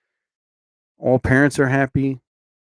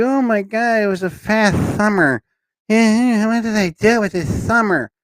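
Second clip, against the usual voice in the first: put-on whiny kid voice; 7 semitones higher than usual. Mimicking kids lamenting summer.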